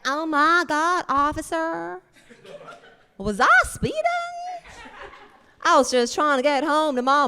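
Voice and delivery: put-on high, slow Southern belle accent. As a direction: play innocent in a Southern accent. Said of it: "starts talking in a southern accent".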